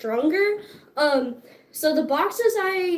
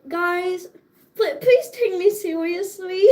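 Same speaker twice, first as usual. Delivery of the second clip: pleading while breaking into laughter; laughing plea; 5 semitones higher than usual. Retold or imitated. To friends who think wrestling is a joke, laughing plea. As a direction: beg through the giggles. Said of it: "and I'm like".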